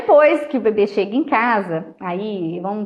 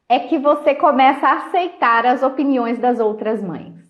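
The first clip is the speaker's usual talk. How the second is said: ordinary delivery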